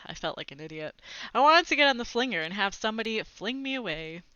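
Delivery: usual speaking voice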